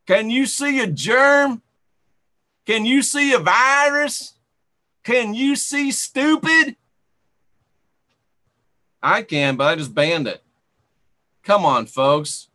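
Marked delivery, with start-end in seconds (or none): 0.1-6.8 mocking questions.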